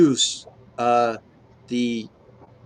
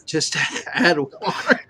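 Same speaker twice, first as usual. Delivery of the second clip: laughing; 4 semitones higher, 3 dB louder than usual. Real, not acted. Reads the funny item laughing.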